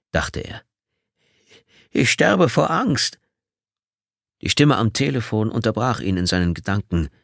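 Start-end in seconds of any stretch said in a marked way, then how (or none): 1.9-3.2 fear. Acted. Panicked thought, fear of dying.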